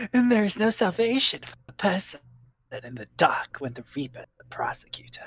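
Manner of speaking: dark, menacing delivery fading to whisper. Acted. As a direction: sound cruel and ominous. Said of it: "saying it's so mean sounding".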